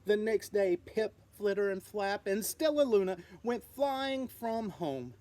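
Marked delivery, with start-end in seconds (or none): none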